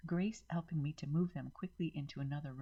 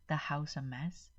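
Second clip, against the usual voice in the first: usual speaking voice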